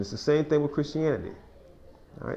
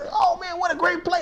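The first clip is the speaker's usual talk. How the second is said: excited. Retold or imitated. Excited sports play-by-play.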